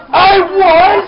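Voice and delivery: high, loud mock protest; indignant outburst. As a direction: shout it back, drawn out, as if challenged. Mock outburst defending his involvement.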